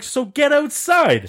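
high, slow, drawn-out dare; confrontational challenge. Retold or imitated. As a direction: call him out to fight. Challenging the kid to fight.

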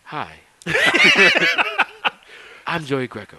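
lightly voiced TV host intro; mock Cheaters host, slightly hushed; a hint of a dramatic reality-show host; Joey Greco impression. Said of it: "I've been dying to do this shit on the podcast".

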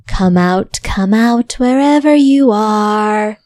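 slow, taunting call out of darkness; dark whisper behind you. Acted. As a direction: boss calling from the dark. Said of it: "It's the sound of your boss from the bar".

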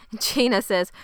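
ordinary delivery

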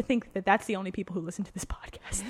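whispered aside; whispering. Real, not acted. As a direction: lean in and whisper it.

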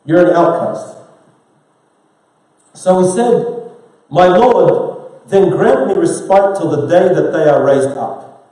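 loud, driving sermon voice; preaching at full force. Acted. Imam preaching to the congregation, forceful. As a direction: preach it hard to the hall.

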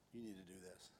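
God's quiet command to the pastor, very soft; hushed voice of God. Retold or imitated. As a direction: drop it almost to a breath. Voice of God retold.

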